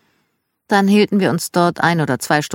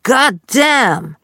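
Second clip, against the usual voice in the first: imitating dad's loud Southern "God damn", higher and louder; loud curse; 7 semitones higher, 4 dB louder than usual. Dad cursing at the dog from his bed, mock loud yell. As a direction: bellow it from the bedroom in dad's voice. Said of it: "hörte ich ein lautes".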